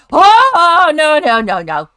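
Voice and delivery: loud, high yell of alarm; panicked shouting; startled panic. Real, not acted. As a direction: cry out in panic, falling as it goes. Scare while walking back.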